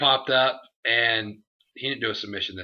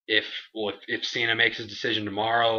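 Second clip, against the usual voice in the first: ordinary delivery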